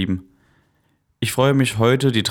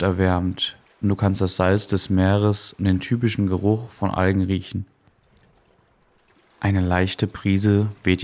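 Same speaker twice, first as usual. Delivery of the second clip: guided meditation. Acted. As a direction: guide gently into relaxation. Leads a dream journey.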